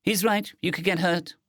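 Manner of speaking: plain reading voice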